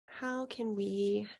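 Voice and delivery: ordinary talk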